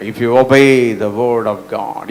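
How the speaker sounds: slow, drawn-out English declaration; measured statement. Real, not acted. Preacher stating a key truth to the congregation, slow and measured. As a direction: state it slowly, word by word. Slow first take of repeated line.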